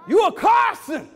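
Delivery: a sudden high, loud burst on the last words; mother's proud shout. Retold or imitated. Mother telling her son he's a Carson, shouting. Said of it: "And she said".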